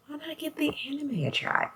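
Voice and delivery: a whispered aside to herself; whispered thought. Retold or imitated. Quoting her own thought.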